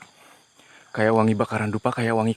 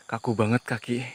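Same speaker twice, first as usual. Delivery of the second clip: ordinary talk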